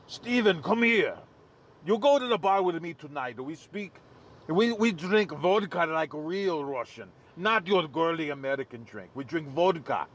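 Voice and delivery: thick imitated Russian accent; Vladimir. Russian friend calling Steven out to drink, imitated Russian accent. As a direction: put on a Russian accent, insistent. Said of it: "I'll give you the accent my friend Vladimir used".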